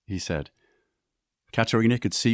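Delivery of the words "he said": usual speaking voice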